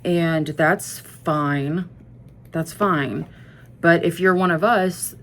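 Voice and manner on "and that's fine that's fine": slow, stretched "And that's fine"; drawn-out concession. Real, not acted. Conceding to casual fans, very slow. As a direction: let it drag out, grudgingly.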